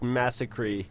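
"massacre" said slowly as MASS-uh-kree; "massacre" ending in -kree, Bugs style; Bugs Bunny pronunciation. Retold or imitated. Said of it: "I like the Bugs Bunny, um, pronunciation of massacre".

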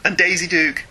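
usual speaking voice

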